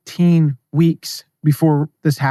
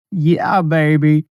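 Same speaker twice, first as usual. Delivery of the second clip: put-on a failed, half-hearted British accent; Austin Powers British accent; much slower than usual. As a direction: try a cheesy British accent and miss. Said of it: "I can't do a British accent".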